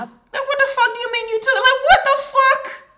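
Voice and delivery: high, loud outraged shout; furious disbelief. Real, not acted. Shouting at the woman in the clip, furious disbelief. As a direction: yell it at the screen in disbelief.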